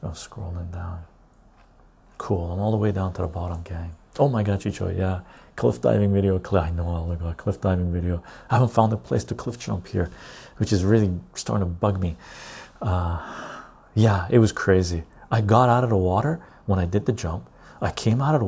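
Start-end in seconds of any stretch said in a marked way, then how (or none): none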